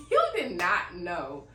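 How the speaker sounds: loud, slow, laughing contradiction; playful laughing protest; laughing disbelief. Real, not acted. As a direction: laugh and dispute it, drawing the words out. Playfully disputes partner's claim.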